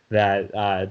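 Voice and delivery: ordinary talk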